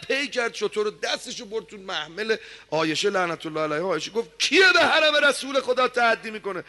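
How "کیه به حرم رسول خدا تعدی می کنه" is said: indignant cry. Acted. Aisha protests the attack.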